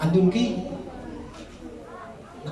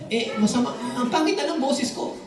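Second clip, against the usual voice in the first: complaining singer; 7 semitones higher than usual. Retold or imitated. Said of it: "Sabi niya".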